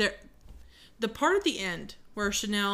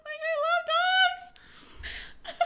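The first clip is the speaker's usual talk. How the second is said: laughing gush. Real, not acted. Gushing over dogs, laughing.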